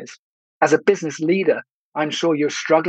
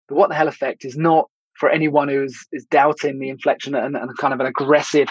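ordinary delivery

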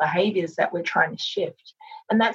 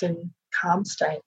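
soothing massage murmur. Acted. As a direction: ease them gently into breathing. Leads a guided breathing meditation.

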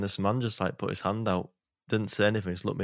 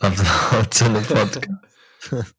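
voice effect; 3 semitones higher, 11 dB louder than usual. Shown as a demonstration. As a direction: boom it through a voice changer. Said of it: "please stop it".

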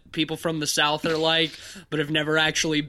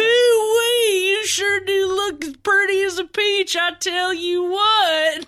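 high, drawn-out hammy Southern drawl; fake Southern accent; 13 semitones higher, 4 dB louder than usual. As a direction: ham up a cowboy oil baron. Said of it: "the fake-ass Southern accent".

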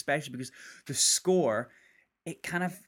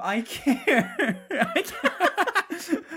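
high, loud protest breaking into laughter; laughing; 6 dB louder, much slower than usual. Real, not acted. Podcaster insisting to co-host he cares, laughing helplessly. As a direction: squeal it out while cracking up.

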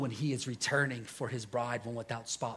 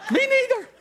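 much higher, louder, laughing; buddy laughing; 10 dB louder than usual. Retold or imitated. Preacher quoting his crying buddy, laughing high. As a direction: squeal it out with a laugh. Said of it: "He's like".

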